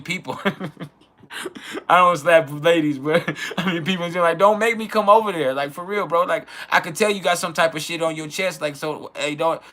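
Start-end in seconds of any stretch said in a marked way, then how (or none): 4.4-5.5 higher and louder hammed-up warning; mock threat. Retelling a warning to people who test him, mock angry. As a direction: bark a playful threat.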